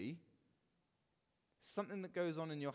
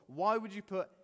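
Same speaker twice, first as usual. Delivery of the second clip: usual speaking voice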